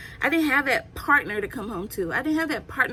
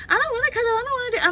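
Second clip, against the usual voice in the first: put-on squeaky high toddler refusing; 13 semitones higher, much faster than usual. Mother imitating her toddler refusing cuddles in a squeaky voice. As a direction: pitch way up like a stubborn toddler. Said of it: "she's gonna be like".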